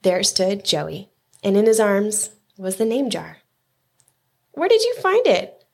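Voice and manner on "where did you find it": high, eager question; excited, high-pitched asking; breathless amazement. A narrator's reading. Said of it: "asked Yunhai breathlessly".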